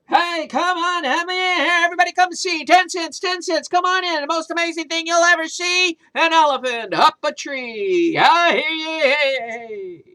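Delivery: loud, high carnival barker shouting to the crowd; circus barker. Acted. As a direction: hawk the show like a fairground crier.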